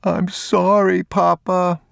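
put-on very high, slow child's wail; beginning to sob. A narrator's reading. Boy sobbing to his father, high tearful apology. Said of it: "He was beginning to sob".

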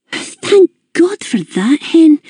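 high, slow, relieved Scottish mum; high, relieved older hostess; Mrs Gibbons relieved. A narrator's reading. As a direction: let the relief out, slowly, pitched high.

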